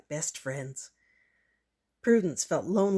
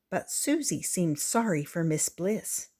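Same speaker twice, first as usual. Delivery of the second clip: ordinary delivery, nothing marked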